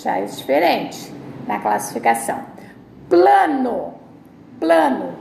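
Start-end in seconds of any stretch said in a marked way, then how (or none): 3.1-5.1 Plano said loud by mistake; slip of the tongue. Shown as a demonstration. Teacher misreading piano as Plano. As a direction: blurt Plano before correcting it. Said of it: "Desculpa, plano não".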